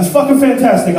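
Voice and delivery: normal talking voice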